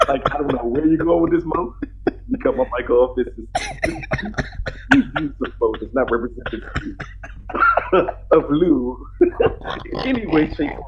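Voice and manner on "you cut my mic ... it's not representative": ordinary talk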